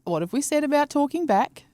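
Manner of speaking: high, prim telling-off; mock scolding parent. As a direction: tut at him like a strict mum. Scolding the watch Neil.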